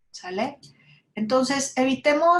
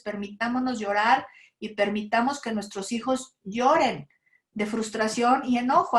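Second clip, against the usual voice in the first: ordinary talk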